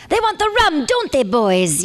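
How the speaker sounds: high, drawn-out pirate woman's holler; pirate redhead. Acted. Redhead pirate taunting the boys on the Disney ride, pirate voice. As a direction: call out to the crew as a sassy pirate. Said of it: "there's a pirate, and she's like".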